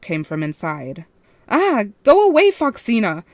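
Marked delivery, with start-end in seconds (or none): 1.5-3.2 much higher, louder panicked yell; terrified night guard shouting; frightened shout. A narrator's reading. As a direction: scream it through the door in terror. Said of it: "she shouted".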